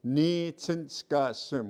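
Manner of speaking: slow, clear "Nitsinskasim"; careful pronunciation. Shown as a demonstration. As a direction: spell out "Nitsinskasim" with the sound. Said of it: "Say that".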